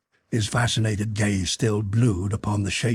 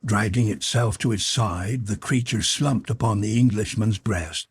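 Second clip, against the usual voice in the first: plain reading voice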